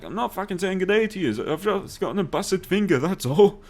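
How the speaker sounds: high, mock-indignant protest; mock indignant retort. Man with a splinted finger answering waving drivers, high mock protest.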